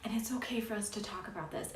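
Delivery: soft whispered reassurance; whispering. Real, not acted. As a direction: drop to a gentle whisper. Soft reassurance after repeat.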